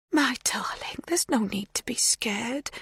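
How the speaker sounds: soothing hushed reassurance; tender whisper. A narrator's reading.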